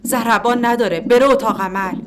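shouted. Acted. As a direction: bark the order across the room. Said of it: "کسی فریاد زد". (فریاد فوری و بلند)

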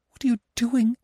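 shocked protest, pushing him away; cried in horror. A narrator's reading. Anna to Gurov kissing her, high horrified cry. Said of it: "she cried in horror".

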